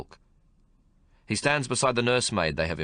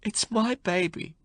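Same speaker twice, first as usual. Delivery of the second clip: put-on tearful young mother; 14 semitones higher, slower than usual. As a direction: hold on to the baby, pleading.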